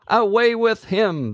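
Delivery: high-pitched crowd yell. A narrator's reading. Crowd yelling at Pilate to take Jesus away. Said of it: "they yelled".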